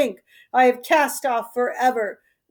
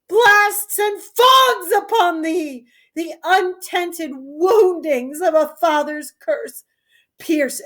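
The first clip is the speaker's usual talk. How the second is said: raging curse; 6 semitones higher than usual. Acted. Father cursing Goneril, raging. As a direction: spit the curse out at her.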